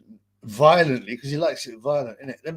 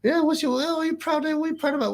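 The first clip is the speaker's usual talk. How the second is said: much higher, light mock voice; 13 semitones higher, faster than usual. A pal asking the other dad, mock high voice. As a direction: pitch it way up like a teasing friend. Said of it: "He goes".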